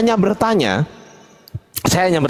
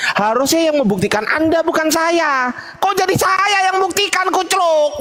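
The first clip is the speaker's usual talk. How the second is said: rising exasperation; 10 semitones higher than usual. Real, not acted. Heated religious debate, exasperated.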